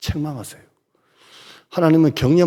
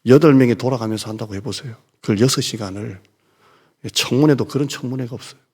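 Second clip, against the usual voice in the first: ordinary delivery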